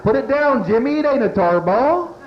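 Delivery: fed-up dad yelling at Jimmy; yelling at kid. Acted. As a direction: holler at the kid to drop it.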